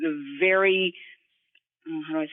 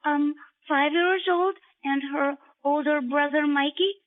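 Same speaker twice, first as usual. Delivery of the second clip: high, bright child cartoon voice; excited little kid; 10 semitones higher than usual. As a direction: sound like a five-year-old girl. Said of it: "Sound like a Chex Kid".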